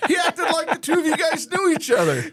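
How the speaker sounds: high laughing shout through the words; laughing teasing remark. Real, not acted. Host laughing at the guest about Jim, high laughing shout. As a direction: crack up while teasing.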